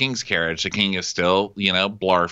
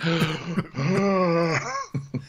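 wheezing gasp; 7 semitones higher than usual. Retold or imitated. King gasps.

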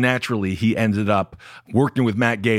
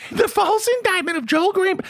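high thin mocking voice; mock him in a reedy falsetto; weak man voice. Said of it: "How do I do a weak man's voice?".

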